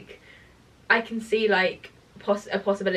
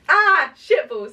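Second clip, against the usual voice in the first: cute swearing; 5 semitones higher, 7 dB louder, slower than usual. Shown as a demonstration. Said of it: "can you please go".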